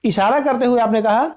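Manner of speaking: ordinary delivery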